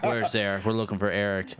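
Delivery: low-pitched retold heavy act; deadpan low demand at the door; mock intimidator. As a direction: imitate a tough visitor, lightly.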